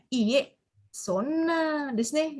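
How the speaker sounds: slow drawn-out iye, sonna desu ne with a rising sonna; hesitant polite decline; demo intonation. Shown as a demonstration. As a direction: model the polite refusal slowly. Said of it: "intonasinya kalau nggak salah ke bawah ya".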